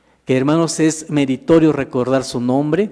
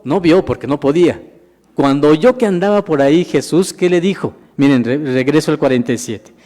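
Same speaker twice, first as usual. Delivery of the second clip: ordinary talk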